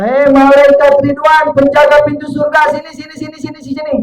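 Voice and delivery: panggilan lantang dan tinggi; memanggil dengan tegas. Acted. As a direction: panggil malaikat dengan wibawa. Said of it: "Kata Allah". (suara memanggil lantang)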